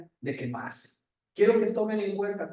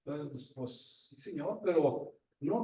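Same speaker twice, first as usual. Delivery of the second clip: much softer, hesitant and slow; hesitant reluctance; 3 semitones lower, 7 dB quieter than usual. Acted. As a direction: stall quietly, unwilling to agree. Imagined reluctant reply to God.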